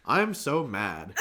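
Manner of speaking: loud, slow mock line reading; stiff announcing. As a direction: imitate an amateur actor announcing each word loudly. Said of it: "announcing it".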